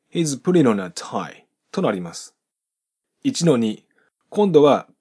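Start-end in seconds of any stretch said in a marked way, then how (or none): 0.1-1.4 "putting on" run together as one word; linking demo. Shown as a demonstration. Teacher repeats "putting on" linked smoothly. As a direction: drop the G, slide N into on. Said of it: "次に続くあ、母音がくっついて".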